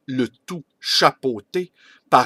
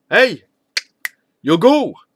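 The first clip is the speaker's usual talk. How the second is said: loud, higher-pitched hail; calling out; 9 semitones higher, 8 dB louder than usual. Acted. Clown hailing Yogourt in the corridor, calling out. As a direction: shout down a corridor to get attention.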